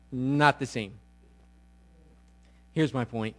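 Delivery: normal talking voice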